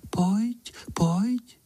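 very high, airy coaxing call; whispering little voice. A narrator's reading. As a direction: beckon in a tiny high voice. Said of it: "a hlásek šeptá". (vysoký vábivý hlásek)

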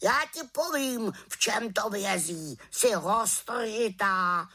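high-pitched lecturing bird; croaking raven. A narrator's reading. Raven tells the witch to concentrate, scolding croak. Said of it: "krákal havran".

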